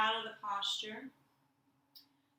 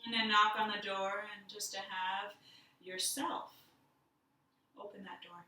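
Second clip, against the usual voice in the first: guided meditation. Acted. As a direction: lead them inward gently, letting silence sit. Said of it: "we'll do a little guided meditation".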